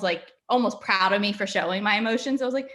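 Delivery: ordinary delivery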